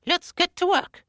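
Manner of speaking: very high squeaky elf; tiny elf voice. Acted. Elves hop onto the counter ready to work, very high. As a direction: chirp it like a busy little elf. Elves speak after popping out.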